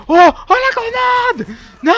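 very high, loud, stretched cry; panicked scream. Real, not acted. Gamer seeing the grenade kill him, panicked scream.

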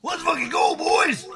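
high, loud rally cry; streamer's hype war cry; hype shout. Shown as a demonstration. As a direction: yell it to pump everyone up. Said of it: "All right, ready?".

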